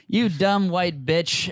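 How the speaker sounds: slow, drawn-out retold insult; quoting rude driver. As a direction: stretch each word like the driver did. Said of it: "he's like".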